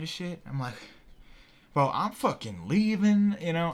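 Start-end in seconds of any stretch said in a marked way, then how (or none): 1.7-3.4 freaked-out exit. Retold or imitated. Freaked out, leaving the trip.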